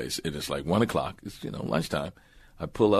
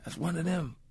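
high, softer imitated sheriff; quiet mock sheriff voice; small-town sheriff; 10 semitones higher, 8 dB quieter than usual. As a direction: say it softly, pitched up, like a local lawman. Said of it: "He goes".

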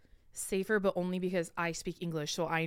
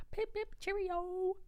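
put-on British accent; 12 semitones higher, 4 dB quieter, slower than usual. Back from England, mock British.

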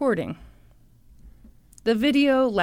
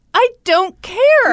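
slow, loud, higher-pitched mock outrage; defiant retort; 10 semitones higher, 7 dB louder, slower than usual. Father brushing off the hotel manager, defiant. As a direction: act out a drawn-out, defiant comeback.

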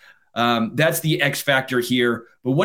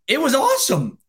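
delighted; 9 semitones higher than usual. Real, not acted. Gleeful about rival's playoff collapse.

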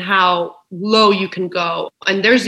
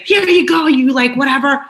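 high, louder mock offhand tone; sarcastic; 5 semitones higher, 4 dB louder than usual. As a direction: toss it at him with bitter sarcasm. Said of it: "I was like".

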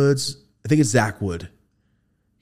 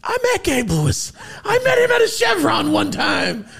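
put-on old man bragging. Old man telling grandkids about meeting the actor, shouting. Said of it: "telling my grand".

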